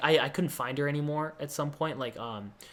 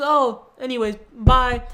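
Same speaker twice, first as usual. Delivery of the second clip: breezy goodbye; 8 semitones higher, 6 dB louder, slower than usual. Retold or imitated. Girl says goodbye.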